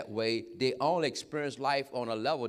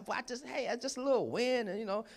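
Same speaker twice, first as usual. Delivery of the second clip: sheepish excuse; 11 semitones higher than usual. Retold or imitated. Man blamed for the storm excuses himself, sheepish excuse. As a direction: play down the trouble, voice pitched up.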